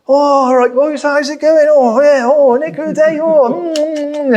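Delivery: high, loud mock-chatty customer; cheery customer. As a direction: mimic an oblivious tea drinker, voice pitched right up. Mimics customers chatting over their tea.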